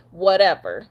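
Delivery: normal talking voice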